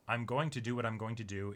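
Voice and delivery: normal talking voice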